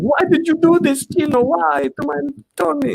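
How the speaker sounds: high, mock-tearful plea; hammed-up heartbroken Maria; imitating Maria crying. Retelling the school play, Maria over Tony. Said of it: "She's like".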